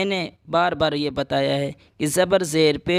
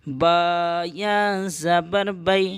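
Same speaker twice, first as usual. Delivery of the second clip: slow spelling ending on بی; slow teacher spelling of بی; slow spelling again; 2 semitones higher, much slower than usual. Shown as a demonstration. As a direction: draw out the spelling, land on بی. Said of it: "یا لین کو جلدی پڑھتے ہیں".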